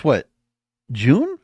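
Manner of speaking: ordinary talk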